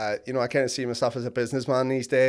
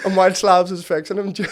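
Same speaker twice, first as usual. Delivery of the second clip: loud, high, through laughter; laughing speech; 6 semitones higher, 6 dB louder than usual. Real, not acted. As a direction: struggle through the punchline laughing. Laughing while retelling the theft.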